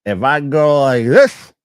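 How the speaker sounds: put-on slow, loud, sloppy drunken reply. Drunk kid answering friends from the toilet, slow loud drunken. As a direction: lift your head and slur it out slowly. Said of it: "I took my head up and I go".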